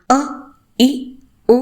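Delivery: అ, ఇ, ఉ each said as a short, separate vowel. Shown as a demonstration. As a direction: cut అ, ఇ, ఉ short, pausing between them. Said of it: "మూల స్వరంలో నాలుగు స్వరాల". (అ, ఇ, ఉ హ్రస్వ స్వరాలుగా పొట్టిగా పలకడం)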